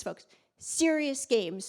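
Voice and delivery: "serious games" slow, each word leaned on; stress on serious games. Shown as a demonstration. Speaker repeating her motto to the audience, slow and stressed. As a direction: spell out "serious games" deliberately. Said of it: "like I say, I repeat this".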